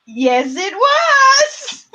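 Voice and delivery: loud start leaping into a high squeal; sudden gleeful shout. Real, not acted. Host making David jump with the answer, gleeful shout. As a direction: shriek the answer to startle them, laughing after. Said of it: "I'm gonna make you jump".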